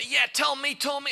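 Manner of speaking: high, loud eager egging on; eager gossip friend. Retold or imitated. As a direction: egg them on, hungry for gossip. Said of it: "stroke your ego and say".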